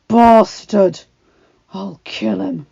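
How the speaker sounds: loud, furious growl. A narrator's reading. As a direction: growl it in rage. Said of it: "he growled".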